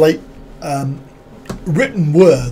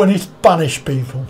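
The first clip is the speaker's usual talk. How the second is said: usual speaking voice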